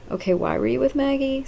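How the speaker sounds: high-pitched puzzled question; confused. Retold or imitated. Mother questioning him about Maggie, confused. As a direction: imitate her baffled questioning. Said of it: "she's confused too".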